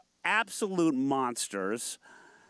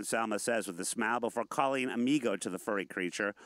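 ordinary talk, nothing marked

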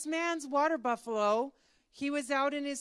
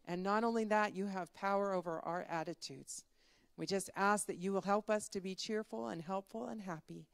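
soft, lower-pitched prayer, fading toward amen; closing prayer; 3 semitones lower, 4 dB quieter than usual. Real, not acted. Leader prays for young people at Faith Camp, reverently. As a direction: pray gently and simply, settling quieter. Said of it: "Let's have a quick prayer".

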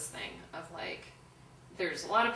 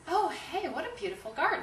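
high, lilting retold compliment; friendly greeting; 9 semitones higher, 5 dB louder than usual. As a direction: play a chirpy, louder hello to a stranger. Friendly greeting to neighbour in retelling.

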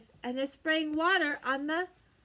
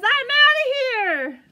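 much higher and louder, slow; panicked fish; 8 semitones higher, 10 dB louder than usual. Acted. Fish fleeing the bird, high loud panicked squeal. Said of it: "The fish says".

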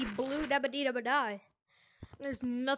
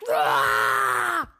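startled scream; 10 semitones lower, 12 dB louder than usual. Real, not acted. Sudden fright in game.

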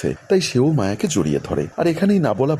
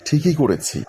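ordinary delivery, nothing marked

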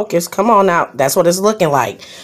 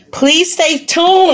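excited plea; 9 semitones higher, slower than usual. Real, not acted.

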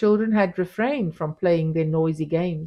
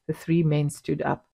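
ordinary delivery

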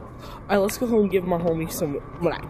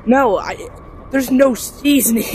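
outraged; 5 semitones higher, 6 dB louder, slower than usual. Real, not acted. Outraged at bland food.

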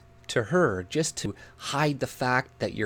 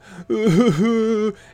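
loud, high burst of laughter; amused laugh; 10 semitones higher, 9 dB louder than usual. Real, not acted. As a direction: laugh out at the mockery. Laughs at his own impression.

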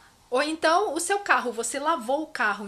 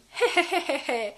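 put-on heh-heh-heh chuckle; deliberate, light demo laugh; demonstrated "heh" laugh. Shown as a demonstration. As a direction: chuckle in a quick run of hehs. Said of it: "você pode rir".

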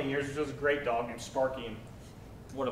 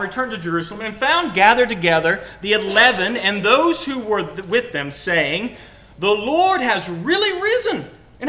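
loud, high scripture reading; emphatic, raised-voice Bible reading; animated preaching; 9 semitones higher, 8 dB louder than usual. Real, not acted. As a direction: preach it out with big pitch swings.

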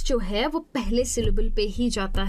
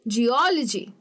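"Geology" with the middle O punched; geOlogy, third-last syllable stressed; slower than usual. Shown as a demonstration. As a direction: hit O in "geology", soften the rest. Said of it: "उसको मैं जोर से कह रही हूँ".

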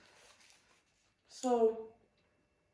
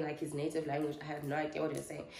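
ordinary talk

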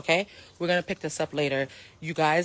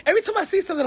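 laughing; 8 dB louder, much faster than usual. Real, not acted. Laughing disbelief.

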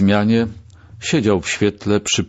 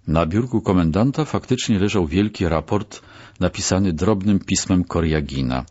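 usual speaking voice

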